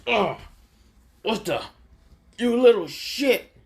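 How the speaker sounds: high, loud furious cry of pain and rage; angry shriek. Acted. Baki clawed from behind, screeching in fury. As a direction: screech it at the attacker, shocked and enraged.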